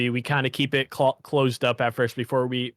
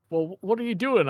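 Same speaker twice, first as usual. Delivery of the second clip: quizzical aunt; 7 semitones higher than usual. Retold or imitated. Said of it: "And she's like".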